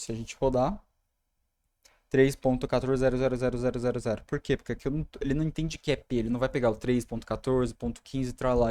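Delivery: usual speaking voice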